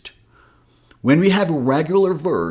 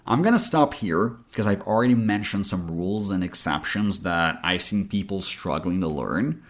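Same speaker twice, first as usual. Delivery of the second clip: normal talking voice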